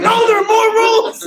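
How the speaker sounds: high, loud comic objection; hammed-up high yell; mock shouted protest. Retold protest to the announcer.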